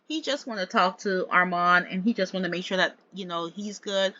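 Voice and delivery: normal talking voice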